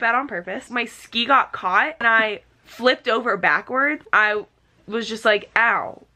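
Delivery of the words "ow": ordinary talk, nothing marked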